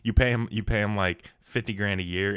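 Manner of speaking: usual speaking voice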